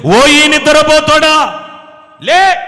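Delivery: loud, high, slow call to wake a sleeper; rousing shout. Acted. God calling the sleeping prophet to wake, loud and high. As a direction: bellow him awake, drawn out.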